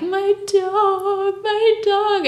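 hammed-up crying wail; imitating bawling. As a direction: wail it like a distraught owner. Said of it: "Just fucking bawling her eyes out".

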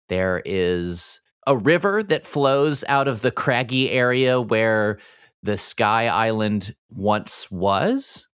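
usual speaking voice

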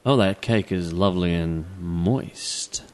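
slow low sultry innuendo; sultry wrong take. Shown as a demonstration. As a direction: purr it like innuendo. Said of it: "it's not like you say it like this".

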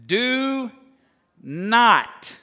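"Do not", each word drawn out and set apart; emphatic DO NOT. Real, not acted. Preacher reading the verse's first two words, emphatic. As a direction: stretch "Do", pause, then land hard on "not".

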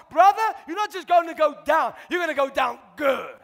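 loud, driving preacher's call; full-force preaching. Acted. Preacher rousing the church about blessing, loud and fervent. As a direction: preach it hard to the congregation.

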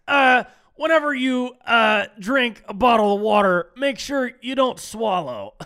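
loud, hammed-up announcement voice; mock official advice. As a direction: parody a public health warning with a shout. Mock public health announcement.

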